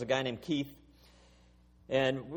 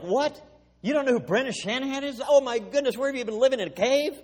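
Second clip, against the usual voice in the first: much higher and louder, incredulous outburst; stunned disbelief; 10 semitones higher, 6 dB louder than usual. Retold or imitated. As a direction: gasp it like they can't believe their ears. Coworkers stunned he doesn't know.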